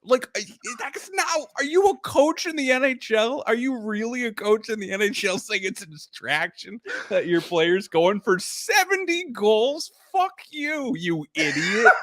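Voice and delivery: high-pitched, exasperated disbelief; scandalised, half-laughing outburst; incredulous rant. Real, not acted. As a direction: rant at the absent coach, tipping into laughter. Ranting at coach's remark, laughing.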